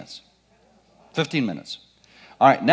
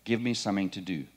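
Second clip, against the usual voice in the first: eager volunteer; 6 semitones lower, 7 dB quieter, faster than usual. Retold or imitated. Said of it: "they say".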